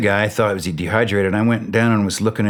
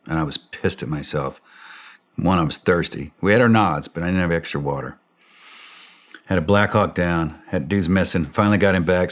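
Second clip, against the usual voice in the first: ordinary talk, nothing marked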